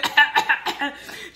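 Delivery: high, loud pretend choking; mock choking cough. Vlogger imitating customers choking on capsules, hammed-up cough. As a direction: fake a choking fit, pitched high.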